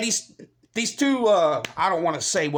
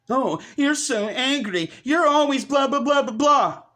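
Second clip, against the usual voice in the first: mocking critics in a higher sing-song; mocking complaint; 6 semitones higher than usual. Critics scold him for being angry, mocked in a higher voice. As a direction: parrot their nagging with contempt.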